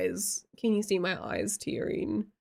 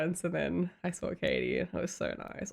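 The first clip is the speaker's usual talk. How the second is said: ordinary delivery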